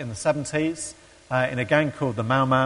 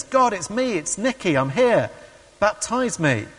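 high, eager call to God; pleading prayer; 7 semitones higher than usual. Retold or imitated. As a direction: imitate someone begging to be noticed. Seeker begs God in the classroom.

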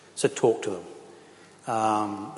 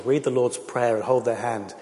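ordinary talk, nothing marked

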